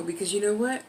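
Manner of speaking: ordinary delivery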